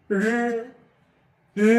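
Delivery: রি held long from the throat; throaty রি. Shown as a demonstration. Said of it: "এটা গলা থেকে".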